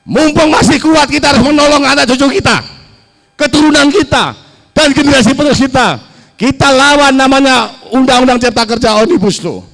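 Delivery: rousing rally shout. Real, not acted. Rally speech rousing workers.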